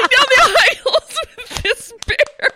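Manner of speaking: laughing through the words, pitch way up; breathless laughing retelling; laughing high. Real, not acted. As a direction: giggle it out, delighted. Laughing at her own story.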